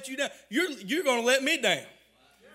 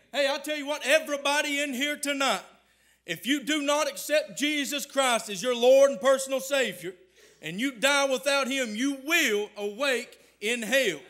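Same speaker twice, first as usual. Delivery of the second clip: ordinary talk, nothing marked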